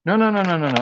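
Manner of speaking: loud, high rush of nos; hurried refusal. Real, not acted. Telling the helper not to fetch it, loud and quick. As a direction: stop her before she runs off.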